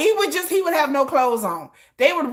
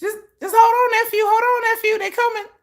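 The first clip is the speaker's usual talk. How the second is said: mock calling out; 11 semitones higher, faster than usual. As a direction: call out to reassure him from afar. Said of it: "I'm like".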